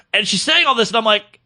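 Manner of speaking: usual speaking voice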